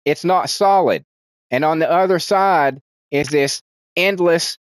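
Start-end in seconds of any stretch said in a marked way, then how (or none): 0.0-1.0 lightly voiced character. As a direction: lightly act out the inviting line. Character explaining the magic wall.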